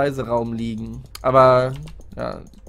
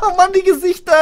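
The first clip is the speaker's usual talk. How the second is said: high loud laugh of fright; shrieking laughter; 7 dB louder than usual. Real, not acted. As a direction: laugh out the scare. Scared by faces, laughing.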